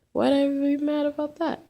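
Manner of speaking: put-on puzzled girl. Lisa puzzled by question.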